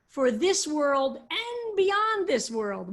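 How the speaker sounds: slow, with beyond said loudly; slow emphatic phrase. Shown as a demonstration. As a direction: spell out each world slowly. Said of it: "So it means you should blot out their name".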